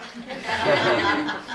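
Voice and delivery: airy laugh falling away; breathless laugh. Real, not acted. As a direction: laugh off the impression. Laughing at own impression.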